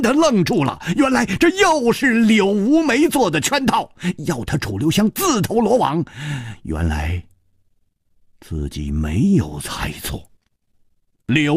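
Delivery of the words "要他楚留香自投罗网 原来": plain reading voice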